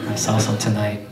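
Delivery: usual speaking voice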